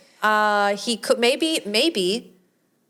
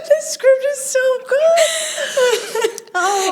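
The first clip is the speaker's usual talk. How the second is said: high, slow, laughing mock praise; cracking up at the script; laughing sarcasm; 14 semitones higher, 4 dB louder, much slower than usual. Real, not acted. Mocking the movie script to her co-host, laughing.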